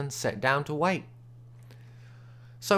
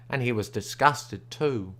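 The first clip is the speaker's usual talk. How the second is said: plain reading voice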